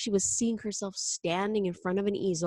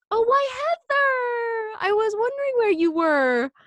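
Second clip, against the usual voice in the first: infused enthusiasm; 6 dB louder than usual. Acted. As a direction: greet a small child with overdone delight. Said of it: "infusing her voice with enthusiasm again".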